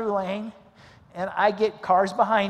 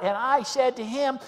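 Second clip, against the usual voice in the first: normal talking voice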